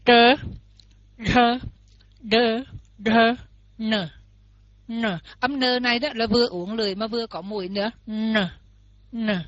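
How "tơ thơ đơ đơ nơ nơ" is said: Tơ thơ đơ nơ, tongue curled, louder; retroflex drill. Shown as a demonstration. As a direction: say each retroflex syllable loud and clear. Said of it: "đưa cái lưỡi lên và mình đặt xuống". (đọc mẫu âm uốn lưỡi thật to)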